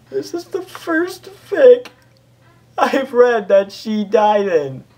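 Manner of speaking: high, slow, loud mock sob building up; hammed-up wailing. Real, not acted. Reader lamenting Derpy's death in the fic, hammed-up crying wail. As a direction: wail over it like an overacted mourner.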